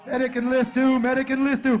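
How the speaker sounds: loud, high call across the field; calling for a medic. Real, not acted. Commentator calling medics to list two, loud. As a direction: shout it so the medics hear.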